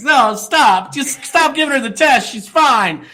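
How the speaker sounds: high-pitched, pleading objection; mock desperate protest. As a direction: beg them to stop, hammed up. Acts out begging them to stop testing.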